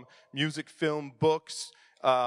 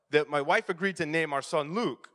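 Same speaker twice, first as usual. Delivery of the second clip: ordinary talk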